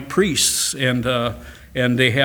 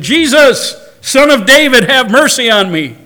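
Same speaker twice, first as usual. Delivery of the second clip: high, loud plea for mercy; a blind man's pleading shout; crying out; 10 semitones higher, 9 dB louder than usual. Retold or imitated. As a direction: call out to Jesus for mercy. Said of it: "the blind man cried out".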